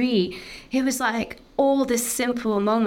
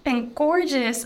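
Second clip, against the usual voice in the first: higher, awed and tearful, slowing on rich and gorgeous; overwhelmed with joy; 9 semitones higher than usual. Retold or imitated. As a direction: relive the moment the beauty of life hit her. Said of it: "I was like".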